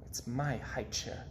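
put-on quiet sulking boy; soft hurt murmur. A narrator's reading. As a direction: say it under your breath, hurt. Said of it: "whispered Peter".

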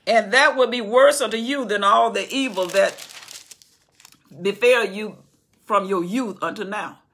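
loud, grave scriptural warning. Acted. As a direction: warn the king with full force. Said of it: "Joab said".

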